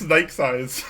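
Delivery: "Nike size" said slowly with a laugh, like NEE-keh; slow, amused correction of "Nike size"; German-style name. Shown as a demonstration. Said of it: "I should say it in German accent".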